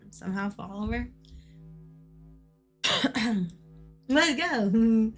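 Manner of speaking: ordinary talk, nothing marked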